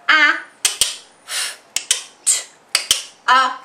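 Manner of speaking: a, f, t, a with pauses between sounds; sounded out. Shown as a demonstration. Teacher sounding out after for the class. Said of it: "If I go".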